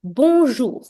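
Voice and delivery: teacher leads the class saying bonjour together, clearly; model bonjour. Shown as a demonstration. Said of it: "Say it with me".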